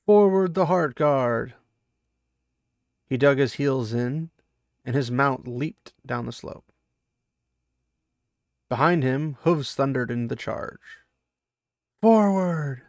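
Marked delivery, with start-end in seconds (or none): 0.1-1.5 loud, slow, drawn-out battle cry; rally the cavalry into the charge; rallying shout. Acted. Commander orders cavalry charge. 12.0-12.8 battle cry. Acted. Leading the charge.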